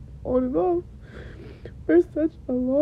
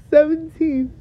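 ordinary talk, nothing marked

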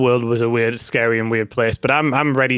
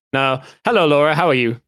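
ordinary delivery

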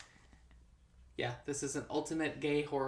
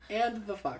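laughing; 7 semitones higher, slower than usual. Real, not acted. Echo, laughing.

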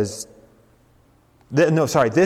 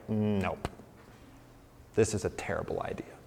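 dismissive mutter; 5 semitones lower, 13 dB quieter than usual. Retold or imitated. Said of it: "they're like".

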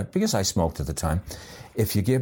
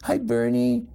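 much higher cooing puppy talk; baby talk; 12 semitones higher than usual. Retold or imitated. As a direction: coo at the puppy like a doting owner. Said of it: "they bring him up".

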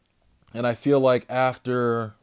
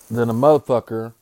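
ordinary delivery

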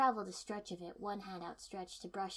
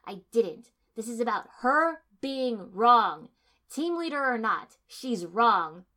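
heated argument; 2 semitones higher, 12 dB louder than usual. A narrator's reading. Kiba arguing with Sai, loud and heated. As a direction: hammer each point home.

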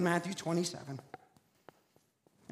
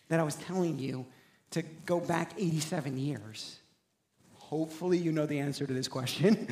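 ordinary talk

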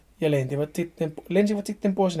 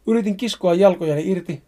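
plain reading voice

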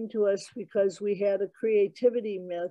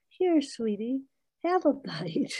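higher, coaxing temptress; sweet high Eve voice; 5 semitones higher than usual. Retold or imitated. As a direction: tempt him sweetly, pitched up. Eve offers Adam the apple.

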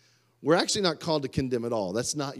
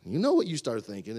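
normal talking voice

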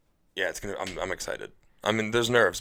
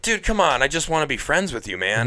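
high, loud retold plea; hammed-up begging, pitched high; mock pleading; 7 semitones higher, 5 dB louder than usual. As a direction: plead with an exasperated buddy, playing it up. Acting out his tweeted plea.

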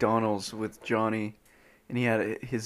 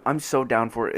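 normal talking voice

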